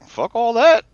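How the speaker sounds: high, loud brush-off; dismissive outburst. Acted. Character dismisses the threat.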